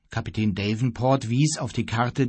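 usual speaking voice

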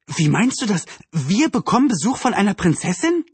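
high, incredulous questioning; astonished. A narrator's reading. Anne questions her mother about the princess visit, astonished. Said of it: "Anne riss die Augen auf".

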